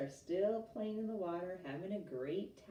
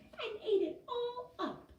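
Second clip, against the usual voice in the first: put-on high squeaky Baby Bear; 3 dB louder than usual. A narrator's reading. Baby Bear sputtering over lost soup, high and squeaky. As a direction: squeak it out like a tiny cub. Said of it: "in a high, squeaky voice".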